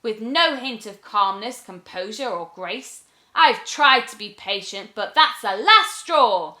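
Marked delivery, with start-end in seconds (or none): 3.3-6.6 louder, fed-up rant; fuming witch. Acted. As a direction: vent your anger.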